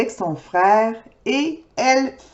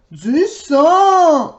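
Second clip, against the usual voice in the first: mock yell; 5 semitones higher, 4 dB louder than usual. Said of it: "hurle le papa de Laurie".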